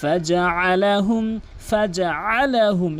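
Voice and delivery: ফাজআলাহুম with ain from mid-throat; measured throaty ain in ফাজআলাহুম; correct ain. Shown as a demonstration. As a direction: slowly recite ফাজআলাহুম, squeezing the ain. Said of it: "আইনের উচ্চারণ করতে হবে হলকের মাঝখান থেকে".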